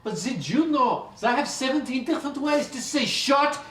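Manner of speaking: high, heated mock French accent. Angry group member mocking the French fact, irate French accent. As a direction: snap back in a put-on French accent.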